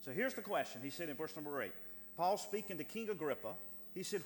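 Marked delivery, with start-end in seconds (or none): none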